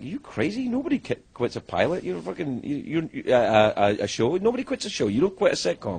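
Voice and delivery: high, disbelieving protest; incredulous producer. Retold or imitated. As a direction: sputter it high, as if he's nuts. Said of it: "And he's like".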